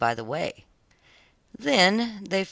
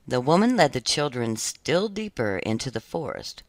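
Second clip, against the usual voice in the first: ordinary delivery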